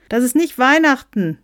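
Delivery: high, loud whiny objection; squawking. Acted. Someone objects to the bishop, squawking. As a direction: squawk it in protest. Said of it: "quäkte jemand".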